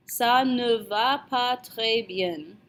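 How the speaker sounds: "Ça ne va pas très bien" slowly, in one connected run; slow, evenly spaced French phrase; careful model repeat. Shown as a demonstration. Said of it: "you're going to say".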